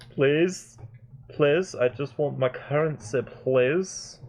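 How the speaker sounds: put-on high, pleading Shrek-style Scottish accent. Player begs for their currency, in a pleading Shrek accent. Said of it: "what this accent is that I'm doing".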